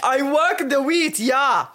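loud, high, slow proclamation; mock farmer boast. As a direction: bellow the farmer's boast in jest. Joins in the farmer bit.

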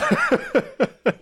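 airy high laugh; breathy giggle. Real, not acted. Laughs after story.

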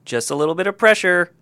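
high, loud soothing doctor. Pretend. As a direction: reassure the patient in a lifted voice. Speculum sponsor joke.

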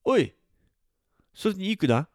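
scolding big sibling. Retold or imitated. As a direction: stop the little kid at the door. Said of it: "Be like".

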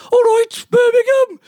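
very high, loud mock Brummie; Birmingham accent. Doctor demonstrates the West Midlands accent, shouted high Brummie. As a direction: belt it out in an exaggerated Birmingham accent. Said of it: "another strange accent".

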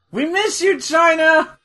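loud, high call to someone far off. Real, not acted. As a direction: shout it to someone far away. Calling out to absent wrestler.

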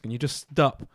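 usual speaking voice

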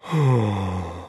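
the king giving in with a huge sigh; big weary sigh. Acted. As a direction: let out an enormous tired sigh. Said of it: "King Leo let out such a big sigh".

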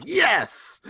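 high, eager yelp; eager April. Retold or imitated. April cheering the Jerry game, high eager yelp. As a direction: jump at the idea. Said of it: "April's like".

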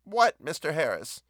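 slow, flat and unimpressed. A narrator's reading. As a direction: give nothing away, slowly. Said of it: "Missus Royster said flatly".

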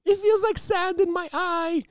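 put-on very high, drawn-out child's wail; mock wailing kid voice; crying kid. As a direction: whine it like an upset little boy. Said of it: "And the kid's like".

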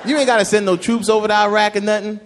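ordinary talk, nothing marked

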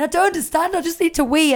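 high, pleading, exasperated whine; desperate park guest. Retold or imitated. Guest desperate for the toilet pleading with staff, high and whiny. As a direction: beg to be told where the toilet is. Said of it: "people were going".

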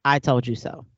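low gloating quote, lightly voiced; a hint of a smug wife; mock smug I told you so. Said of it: "never say".

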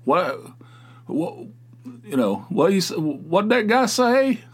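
hammed-up grandpa squinting at the TV; old man. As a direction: play a deaf old man straining to hear. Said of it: "Like some old man".

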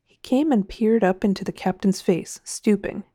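plain reading voice

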